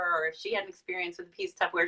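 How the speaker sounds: ordinary talk, nothing marked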